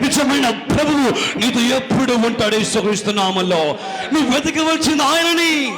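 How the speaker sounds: fervent preaching. Acted. Pastor exhorting the congregation, rising fervent preaching. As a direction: press the call to seek God.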